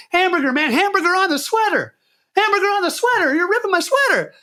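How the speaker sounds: very high, loud desperate squeal; panicked actor begging the director to stop ripping his sweater; frantic shrieking plea. Retold or imitated. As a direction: scream the safe word as the sweater rips. Begging while sweater gets ripped.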